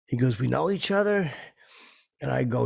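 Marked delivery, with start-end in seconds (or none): 0.4-1.3 higher-pitched retold question; mildly incredulous, rising high on the question. Retelling the star's reply to his greeting, incredulous.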